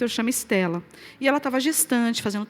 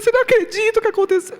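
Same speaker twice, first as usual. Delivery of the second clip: excited disbelief; 9 semitones higher, 6 dB louder, faster than usual. Retold or imitated. Calling husband with news.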